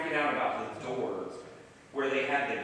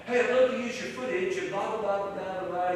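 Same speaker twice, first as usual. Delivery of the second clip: higher-pitched imitation of an eager caller; British caller; 6 semitones higher than usual. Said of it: "It was this British chap".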